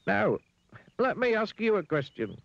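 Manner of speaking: very high, self-assured voice; Mister Dizzy. A narrator's reading. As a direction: turn the tables in a piping voice. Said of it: "said Mister Dizzy to the pig".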